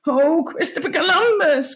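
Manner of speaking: put-on high exclamation from a young hero; dismayed cry. A narrator's reading. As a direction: cry out in comic alarm. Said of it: "cried Mungo".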